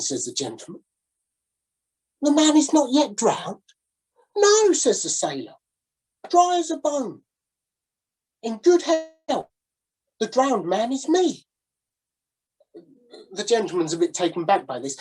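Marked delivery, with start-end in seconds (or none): 2.2-11.5 higher, slow showman sailor; a hammed-up carnival barker; sailor's sales pitch. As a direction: sailor revealing he is the drowned man. Dialogue voiced in story.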